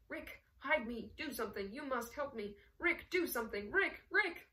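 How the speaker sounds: beg, then cry out the name; desperate pleading. Acted. Ugart begs Rick as guards close in, pleading desperately. Hunted man begs as he is seized.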